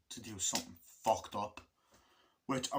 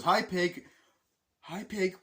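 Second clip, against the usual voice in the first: mock soldier taunt; 8 semitones higher, 6 dB louder, slower than usual.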